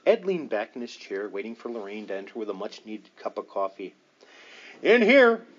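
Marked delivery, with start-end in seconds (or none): 4.8-5.5 loud, high call to another room. A narrator's reading. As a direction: shout it down the passage. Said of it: "Warren called out".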